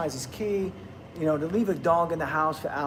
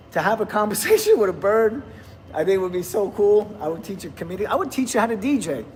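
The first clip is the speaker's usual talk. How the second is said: ordinary talk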